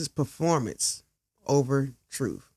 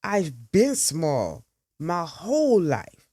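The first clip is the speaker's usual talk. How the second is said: slow, pressed words through clenched teeth; through her teeth; slower than usual. A narrator's reading. Talia answers Naomi's accusation, slowly through her teeth. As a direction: grind each word out slowly. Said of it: "Talia says through her teeth".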